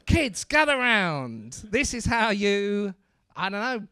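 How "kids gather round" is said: slow mock teacher call. Business teacher gathering the kids, mock classroom voice.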